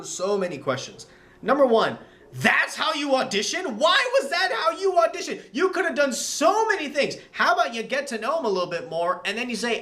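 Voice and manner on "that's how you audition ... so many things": incredulous. Real, not acted. Storyteller questions the actress's audition method, incredulous. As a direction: demand an answer, pitch way up. Indignant reaction to the story's twist.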